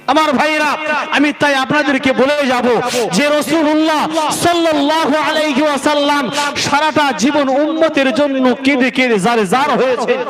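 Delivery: sustained high sermon delivery; impassioned preaching. Real, not acted. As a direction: proclaim it earnestly to the congregation. Preaches at raised pitch.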